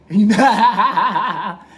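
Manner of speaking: long, high, loud laugh; real laugh. Real, not acted. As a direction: burst out laughing heartily. Laughs after telling phone call.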